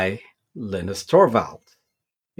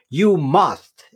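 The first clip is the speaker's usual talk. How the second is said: must punched higher, with a falling tune; stress on must; 4 semitones higher than usual. Real, not acted. Advising Linux beginners, with firm weight on must. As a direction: hit the word must firmly. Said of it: "I use the word must here".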